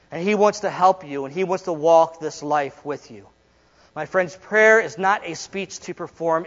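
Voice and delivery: ordinary delivery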